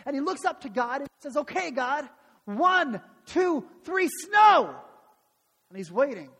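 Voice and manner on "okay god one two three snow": higher and louder with each word, ending in a yell; commanding countdown. Acted. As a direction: count up to a big shouted order. Kid counts down, commanding snow.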